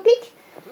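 high little click; click sound. Retold or imitated. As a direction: mimic the phone's click. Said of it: "¿Veis que hace ese sonidito?".

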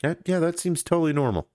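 sarcastic breezy approval; a touch of sarcastic shrug; mock agreement. Host mimics people shrugging it off, sarcastically. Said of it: "people are just like".

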